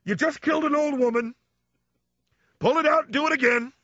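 high, strident mock father scolding; imitating dad. Dad scolding his son after a parking mistake, high and shrill. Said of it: "My dad would go".

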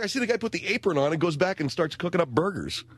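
ordinary delivery

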